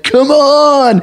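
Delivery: high, drawn-out mock holler; retold rallying call. Leading the cops back, calling out.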